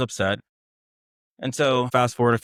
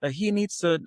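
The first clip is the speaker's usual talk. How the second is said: retold yelling; 10 semitones higher than usual. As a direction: imitate an upset wife complaining in a high voice. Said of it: "she's, like, yelling at my mom".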